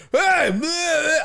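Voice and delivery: very high gibberish heckling; high, mocking babble; mock screaming heckler. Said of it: "He just started screaming at me".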